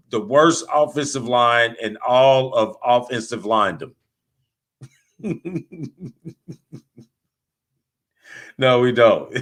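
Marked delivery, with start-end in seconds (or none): 5.2-6.5 soft, high chuckle; laughing. Real, not acted. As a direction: break into a light, pulsing laugh. Host cracks up mid-rant.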